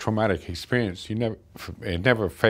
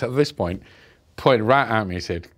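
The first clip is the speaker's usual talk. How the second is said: ordinary delivery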